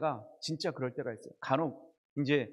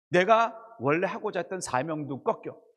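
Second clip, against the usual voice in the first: normal talking voice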